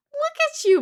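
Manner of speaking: squeaky ventriloquist dummy. Retold or imitated. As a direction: pipe it up high and teasing. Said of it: "at one point I was like".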